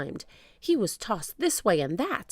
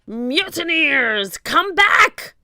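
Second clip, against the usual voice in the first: slow bellow climbing higher; furious shout; 10 semitones higher, 9 dB louder, much slower than usual. A narrator's reading. Captain calling back his fleeing crew, shouting. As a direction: bellow after deserting men. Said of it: "shouted Cap'n Bones".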